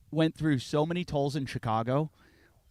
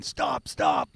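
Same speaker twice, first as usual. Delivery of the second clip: high mock cop calling stop; imitating the cop chasing him for jaywalking; 10 semitones higher than usual. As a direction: bark it like a distant cop. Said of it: "so the cop's going".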